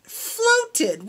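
"Floated" said high and clear on its own; word repeated. Shown as a demonstration. Teacher singling out "Floated" for children. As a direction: pick out "Floated" from the story for the kids. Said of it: "What do you think floated means?".

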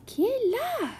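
the little tulip crying out to the visitors; cries out. A narrator's reading. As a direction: call out sharply through the door. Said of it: "cria-t-elle".

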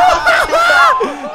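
very high, loud shouted laugh; shrieking laugh. Real, not acted. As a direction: howl with delight. After the goal.

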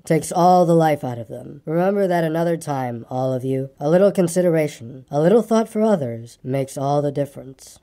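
low, gloomy donkey voice; low, long-suffering grumble; melancholy grumbler. Acted. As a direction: lecture them wearily, low and mournful.

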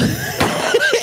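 high burst of laughter. Real, not acted. Laughing at the photo.

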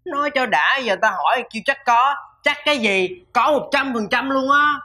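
exasperated scoffing. Acted. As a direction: tease him for a lame answer. Older brother scoffs at little brother.

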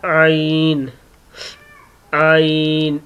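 आइन from the middle of the throat; Arabic ع from the throat; throat letter demo. Shown as a demonstration. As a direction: squeeze आइन out of mid-throat. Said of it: "फिर देख ले".

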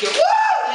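shrill triumphant whoop; high whoop. Acted. Contestant whoops after being picked, very high. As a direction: let out a high victory whoop.

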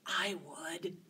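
soft whispered confession; hushed aside. Real, not acted.

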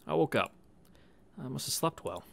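ordinary delivery

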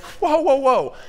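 high, loud startled whoa; a disciple protesting, loud and startled; disbelieving whoa. Retold or imitated. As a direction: throw up your hands in disbelief.

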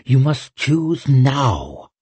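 slow, drawn-out, weighty urging; insistent witch. Acted. Witch demanding an immediate choice, insistent. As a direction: draw out each word to the last.

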